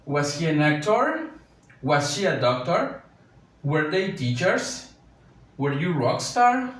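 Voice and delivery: slow, with each question rising at the end; teacher models rising English question tune; question intonation. Shown as a demonstration. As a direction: read each question slowly and lift the last word. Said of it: "hay que igual darle la entonación de pregunta".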